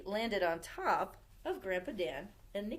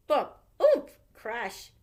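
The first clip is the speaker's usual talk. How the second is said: sound effects; 3 semitones higher, 11 dB louder, much slower than usual. A narrator's reading.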